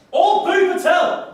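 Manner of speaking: loud, drawn-out call for the crowd to boo; rally the audience into booing; crowd-leading shout. Acted.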